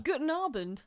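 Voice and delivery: German greeting. Pretend. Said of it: "We don't do the Germans".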